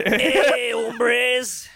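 loud, hammed-up Mexican-accent greeting; mock Mexican accent. Joker taunting his absent wife, loud mock Mexican accent. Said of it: "I'll just make fun of Mexicans".

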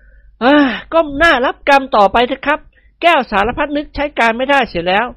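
high, slow voice of fake sympathy; mock-regretful young man. A narrator's reading. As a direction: console him, half-teasing. Nikorn consoles father-in-law. (นิกรปลอบพ่อตาแบบแกล้งเสียดาย)

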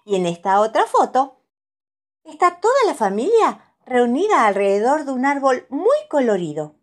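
put-on high, bright little-girl delight; Pepa's voice. A narrator's reading. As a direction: gush like an excited little piglet. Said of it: "dice Pepa".

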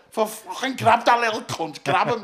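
loud and high, urgent; retold yell. Pinned against the wall shouting to mate, loud. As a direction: bellow at your mate to grab him. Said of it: "I went".

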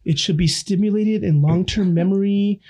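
pompous professor voice, drawn out; smart-guy impression. Imitates brainy friend Adam, high slow know-it-all voice. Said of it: "Adam, Adam".

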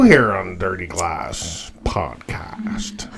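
low, slow, husky near-whisper; hushed, drawn-out host voice; mock-mysterious intro. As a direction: drop deep and slow for a teasing question. Mock-dramatic question in intro.

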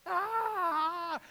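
high mock scream of fright; sons' scream. Preacher mimics his sons screaming at a mouse, high mock shriek. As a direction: imitate the kids shrieking at a mouse. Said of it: "are screaming and yelling".

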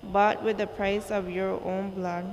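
ordinary delivery

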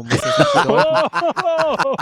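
high, loud and slow laughing protest. Real, not acted. Defends himself, laughing.

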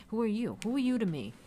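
high-pitched mock challenge; mock indignant. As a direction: act out an incredulous put-down. Mock confrontation in acted quote.

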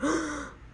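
short startled gasp in; alarmed gasp; sharp gasp. Real, not acted. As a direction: catch your breath in shock. Sees his room.

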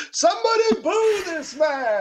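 high, loud call to the crowd; rally the crowd to boo; mock outrage shout.

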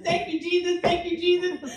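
praising with relief and joy; a happy shout of praise in the field; joyful praise. Retold or imitated. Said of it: "having a praise at singing time".

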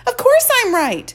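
high, loud mock boast; playful boast. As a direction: brag with a wink.

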